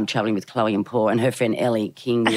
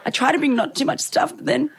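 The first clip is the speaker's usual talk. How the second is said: high defensive protest; 10 semitones higher than usual. Retold or imitated. Defending herself to Paul.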